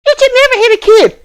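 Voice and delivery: high, fast, prissy mock voice; mocking scold. Mocking people who say never to hit a kid, in a high squeaky voice. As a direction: squeak it out like a scandalised do-gooder.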